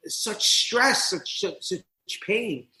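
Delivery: usual speaking voice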